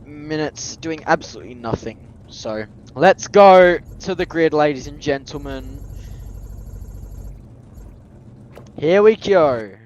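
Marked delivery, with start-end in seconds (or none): 3.0-9.7 race announcer. Acted. Announces the start like a broadcaster.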